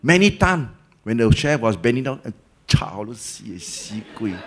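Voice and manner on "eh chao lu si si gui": muttered curse. Retold or imitated.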